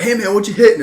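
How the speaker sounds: high, loud gym-bro greeting; hyped gym bro. Retold or imitated. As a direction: pump him up like a gym buddy. Voicing gym friend.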